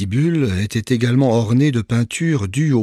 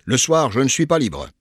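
slightly raised boisterous friend; a lightly voiced jovial friend; 4 semitones higher than usual. A narrator's reading. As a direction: toss off the excuse. Patience explaining departure. (ami jovial, voix à peine marquée)

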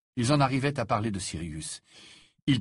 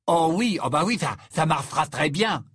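mock-enthusiastic, raised voice; sarcastic; 5 semitones higher, 5 dB louder than usual. Ron scoffs at the matchmaking plan, sarcastic. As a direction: agree emphatically while meaning the opposite. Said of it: "assura Ron d'un ton sarcastique".

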